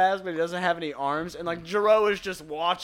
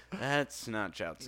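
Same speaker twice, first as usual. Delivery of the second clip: slow, soft, lowered reply; deadpan realization; 4 semitones lower, 8 dB quieter, slower than usual. Retold or imitated. Character examining the android's head, deadpan. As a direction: let it sink in slowly, quietly. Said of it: "He's like".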